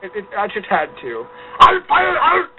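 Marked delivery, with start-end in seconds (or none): 1.6-2.5 put-on loud, high, hammy German accent; bad German accent. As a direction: bellow it like a movie German villain. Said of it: "sounds like a very bad German accent".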